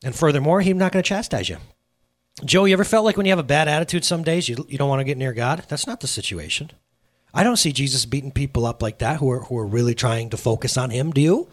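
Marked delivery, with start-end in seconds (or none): none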